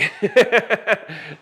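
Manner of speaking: a loud burst of real laughter; hearty laugh. Real, not acted. Installer laughing about the speaker bracket's weight, hearty laugh.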